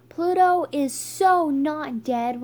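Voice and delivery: loud, high, slow protest; defiant outburst. A narrator's reading. Stink breaks the silence to defy Riley, loud defiant outburst. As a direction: push each word out emphatically.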